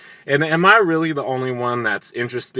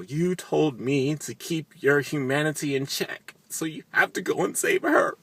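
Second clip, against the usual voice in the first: higher, half-mocking plea to act; lightly voiced urging Jessica; 6 semitones higher, 3 dB quieter than usual. Jessica urging Bill to save her, lightly mocked. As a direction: nudge him along in a girlish put-on voice.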